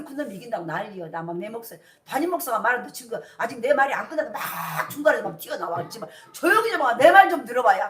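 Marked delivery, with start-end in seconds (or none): 5.9-7.9 loud, raised, mock-exasperated outburst; exasperated demand. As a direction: act out demanding to be heard. Acts out being interrupted while speaking. (답답해서 버럭하는 시늉)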